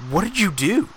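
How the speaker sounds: high, incredulous imitated question; hammed-up disbelief; mock astonishment. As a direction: play an amazed onlooker, pitch jumping up. Other birds amazed at her pile.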